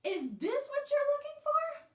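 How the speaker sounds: very high, loud excited question; librarian character. A narrator's reading. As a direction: ask as the librarian who finally understands. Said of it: "said the librarian".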